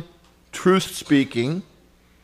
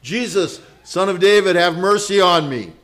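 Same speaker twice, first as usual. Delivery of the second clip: louder, insistent plea; crying out loud; 3 semitones higher, 7 dB louder than usual. Retold or imitated. Beggar ignores the crowd and calls out again, loud plea. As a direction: keep calling out, refusing to be hushed. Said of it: "kept crying out".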